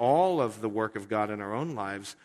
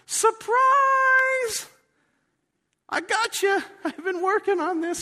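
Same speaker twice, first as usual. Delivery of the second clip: much higher, loud gleeful shout; yelling surprise; 8 dB louder, slower than usual. Retold or imitated. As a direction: leap out and yell it as God. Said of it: "God jumps out and yells".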